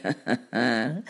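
low staged chuckle; short, deliberate ha-ha-ha; cat's chuckle. Acted. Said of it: "he laughed".

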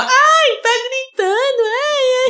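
very high whiny falsetto mocking complaints; mocking complainers. Mocking viewers who complain he shouts, whiny falsetto. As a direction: whine in falsetto like annoyed viewers. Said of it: "Aí o povo reclama".

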